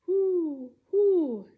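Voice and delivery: high, loud owl hoots. Pretend. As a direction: hoot like an owl at night. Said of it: "like an owl".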